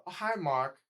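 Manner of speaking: put-on a caricatured European accent. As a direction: imitate a man with a European accent. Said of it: "the European accent who's like".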